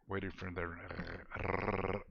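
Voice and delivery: slow "Waiting for the", ending in a rolling rrr; rolled R. Retold or imitated. Streamer imitating a viewer who rolls the R's, long trilled R after "the". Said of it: "yeah, she rolls the R's".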